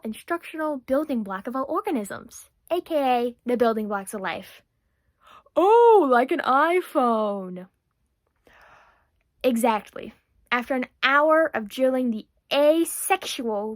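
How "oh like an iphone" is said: exaggerated dawning comprehension; dim boy's realisation. Boy finally grasping tutor's lesson, dimly delighted.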